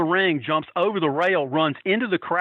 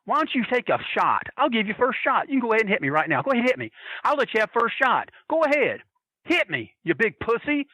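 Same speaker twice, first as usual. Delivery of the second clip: retold daring taunt; 6 semitones higher than usual. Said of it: "And he goes".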